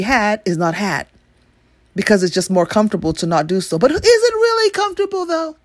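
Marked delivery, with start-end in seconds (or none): 3.8-5.6 high mocking question; sarcastic high voice. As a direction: squeak it out sarcastically.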